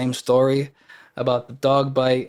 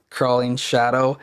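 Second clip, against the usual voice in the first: normal talking voice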